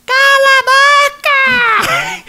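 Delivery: very high, squawking parrot voice with laughter; parrot imitation. Parrot mimicking the mother at home, squawky high screech. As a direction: screech it like a parrot copying mom. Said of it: "o papagaio fazia assim".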